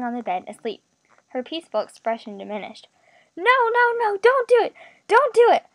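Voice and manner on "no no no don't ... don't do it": high, loud terrified pleading; frantic, raised cries of protest; nightmare panic. A narrator's reading. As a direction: cry out in fear from a bad dream. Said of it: "in the middle of a nightmare".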